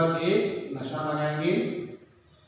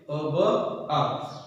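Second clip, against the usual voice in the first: slow spelled-out reading; much slower than usual. Shown as a demonstration. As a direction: slowly, sound by sound. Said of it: "क्या पढ़ेंगे?". (धीमे-धीमे अक्षर जोड़कर पढ़ना)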